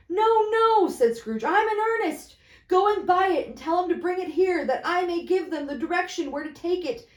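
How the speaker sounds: put-on excited old man leaning out; eager Scrooge. Scrooge urging the boy.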